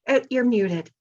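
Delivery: ordinary talk, nothing marked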